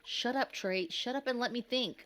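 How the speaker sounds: a moderate angry yell back. A narrator's reading. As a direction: shout him down in desperation. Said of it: "Scott yelled back".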